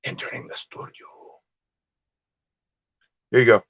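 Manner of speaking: whispered mock Russian Greek accent. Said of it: "That was my Russian Greek accent".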